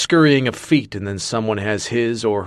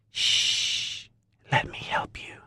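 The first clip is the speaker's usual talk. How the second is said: hushing whisper; 8 dB quieter, slower than usual. Acted. Woman soothing drugged Marcus.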